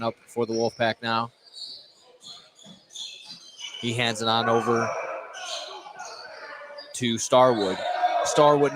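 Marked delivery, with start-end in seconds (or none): none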